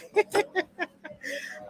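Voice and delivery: high soft laugh through tears; soft choked laugh while near tears; teary laugh. Real, not acted. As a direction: laugh to fight off crying. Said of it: "Let me not cry now".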